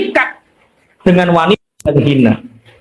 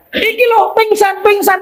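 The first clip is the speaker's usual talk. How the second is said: panik; 12 semitones higher than usual. Acted. Melapor panik ada yang pingsan.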